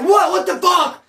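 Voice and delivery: sudden loud, high angry outburst. Retold or imitated. Wedo turning on the cop, loud outburst. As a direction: blow up at him in disbelief. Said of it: "Wedo decided to be like".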